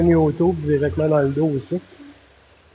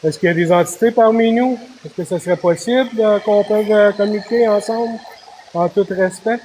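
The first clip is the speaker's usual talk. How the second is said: high, earnest call to the spirits; 6 semitones higher than usual. Acted. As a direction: summon the ghosts politely. Calling out to spirits on a ghost hunt.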